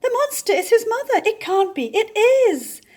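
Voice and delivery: high, fast, loud excited voices; excited cries. Acted. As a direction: shriek it in shocked excitement. Excited creatures shouting in story.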